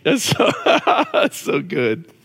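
normal talking voice

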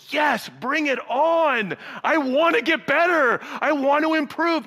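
high, loud, slow hammed-up eagerness; mock eager welcome. Preacher mocking how nobody welcomes criticism, hammed-up eagerness. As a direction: overplay a keen student begging for correction. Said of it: "do any of us go".

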